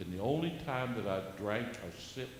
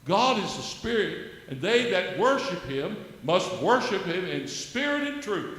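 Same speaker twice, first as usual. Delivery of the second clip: loud, higher preacher's proclamation; preaching at full force; 6 semitones higher, 9 dB louder than usual. Acted. Exhorting the host and viewers, loud high preaching. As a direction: proclaim it like from the pulpit.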